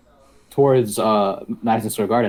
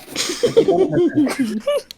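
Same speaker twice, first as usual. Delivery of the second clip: a long, high rising laugh. Real, not acted. Laughing at own story.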